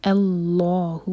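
اللہ with a heavy lam held two counts; heavy lam, stretched. Shown as a demonstration. Teacher demonstrates اللہ slowly for the class. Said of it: "لام جلالہ heavy اور دو حرکہ کھینچا جائے گا".

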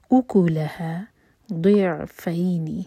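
voiced, very slow tajweed recitation; slow Quran recitation. Shown as a demonstration. Teacher recites the verse to show the rules, slowly. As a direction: draw each syllable out carefully. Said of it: "pronunciation ہے وہ بالکل crisp نکلے".

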